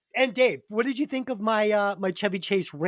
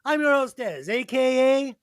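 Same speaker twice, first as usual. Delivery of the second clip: announcer intro; 5 semitones higher, 4 dB louder than usual. Acted.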